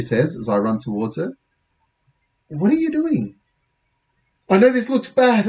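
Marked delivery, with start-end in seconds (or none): 2.5-3.4 put-on high, incredulous girl's voice; questioning Lisa. Girl to boy running at her, high and incredulous. 4.5-5.5 high, urgent excuse; hurried excuse. Acted. Boy to friend while police chase him, urgent and high. Andy explains while running.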